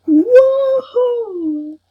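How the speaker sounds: loud, high, drawn-out whoop; thrilled amazement. Real, not acted. Player seeing the new game world, thrilled amazement. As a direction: burst out in real wonder.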